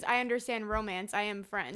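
flat, quiet, disconnected I am French; flat French statement. Retold or imitated. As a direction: state I am French flatly as a plain fact. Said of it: "his inflection on the line".

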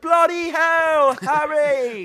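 high, slow British exclaim; hammed-up British accent; mock British accent. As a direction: stretch it out in a silly British accent. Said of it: "when you do this accent".

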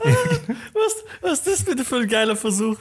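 high laughing outburst; laughing disbelief. Real, not acted. Reacting to co-host's release idea, laughing.

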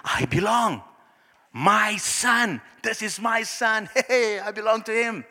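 high, elated discovery of belonging; delighted, lifted exclamation with a laugh; joyful realization. Acted. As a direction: exult as the son who is claimed. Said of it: "What did Jesus hear?".

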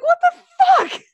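high, loud, slow disbelieving cry; shocked what-the-hell outburst; outraged disbelief. Retold or imitated. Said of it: "I'm like".

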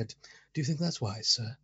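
put-on posh English accent. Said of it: "they went with his original".

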